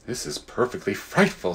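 a soft, dismayed aside. Acted. Diggory aside to Polly, softly dismayed. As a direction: murmur it so the Queen won't hear. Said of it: "said Diggory to Polly".

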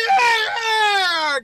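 very high, loud, stretched-out cry; hype scream. Real, not acted. Vlogger pumped up in the gym, high screaming yell.

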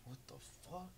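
barely audible, stunned question; hushed bewilderment. Retold or imitated. Boy woken at four in the morning, hushed and bewildered. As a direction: say it under your breath, as if just woken.

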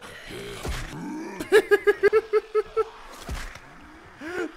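loud high burst of laughter; real laughing fit; high cackling laugh. Real, not acted. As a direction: crack up at the game. Laughs at funny game moment.

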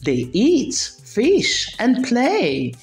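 ordinary delivery, nothing marked

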